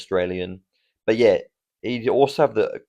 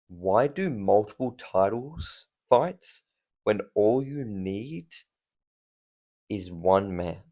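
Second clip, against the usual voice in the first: slow, soft, hushed teaser; dramatic hush; 5 dB quieter, slower than usual. Pretend. Vlogger teasing his big pick in a slow dramatic hush. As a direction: lean in and slowly build suspense.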